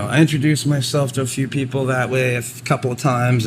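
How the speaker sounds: normal talking voice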